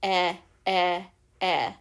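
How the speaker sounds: repeated "Eh" with the mouth half open; short e sound. Shown as a demonstration. Teacher to kids, contrasting short E. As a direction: open halfway for "Eh". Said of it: "When you say the short E sound".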